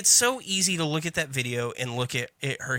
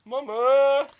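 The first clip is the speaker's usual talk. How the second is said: very high, loud cry for mama; hammed-up childlike call; Gilbert Grape impression; 13 semitones higher, 5 dB louder than usual. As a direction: holler it as a film quote. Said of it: "Gilbert Grape".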